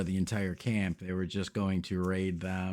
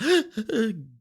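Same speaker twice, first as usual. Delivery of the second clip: high, sharp gasping sob; hammed-up sobbing gasp of a scared child; child's shuddering gasp; 6 dB louder than usual. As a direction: gasp in a shaky breath like a crying boy. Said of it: "shuddering kind of sob".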